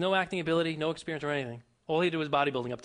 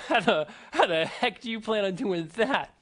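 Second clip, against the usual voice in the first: laughing through the words, louder and slow x0.6; mocking laugh; 4 semitones higher, 5 dB louder, slower than usual. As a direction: scoff at him while laughing, slowly. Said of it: "laughs in his face and says".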